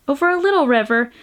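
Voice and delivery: plain reading voice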